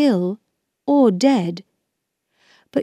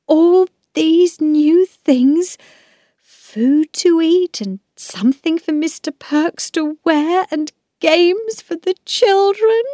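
much higher voice, lightly tearful with gratitude; overcome, happy tears; 10 semitones higher than usual. A narrator's reading. Wife lists the birthday gifts, tearfully overjoyed. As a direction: marvel high at the gifts, close to happy tears. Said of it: "started to cry again".

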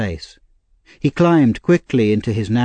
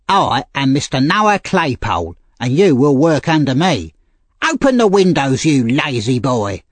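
put-on bossy boy, pitched up; bullying older boy; 4 semitones higher than usual. A narrator's reading. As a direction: lord it over the new orphan.

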